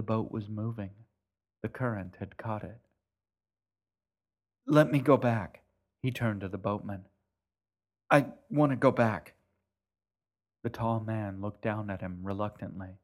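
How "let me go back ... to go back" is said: loud, urgent begging; desperate plea. Acted. Boy begging the boatman to go back. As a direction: plead hard to be let go back.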